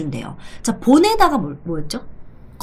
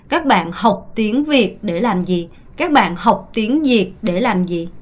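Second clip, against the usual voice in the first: slow model question; much slower than usual. Shown as a demonstration. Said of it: "질문을 하나 해볼 테니".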